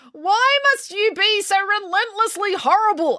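very high, whining accusation; accusing. A narrator's reading. Finn blames April for being horrible, accusing. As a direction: accuse her in a high, aggrieved wail. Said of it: "accused Finn".

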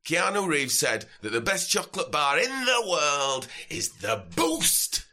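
hammed-up sozzled Yorkshire lad; drunk Yorkshireman. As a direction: drunk Yorkshire festival-goer demanding a wristband. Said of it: "determined slasher from Yorkshire".

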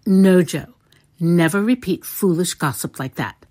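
loud, grave motherly reproof. A narrator's reading. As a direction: forbid it firmly and gravely. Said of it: "said Missus March gravely".

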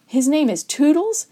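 scoffing astonishment; disbelief. A narrator's reading. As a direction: rise sharply on the silly name. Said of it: "face registered disbelief".

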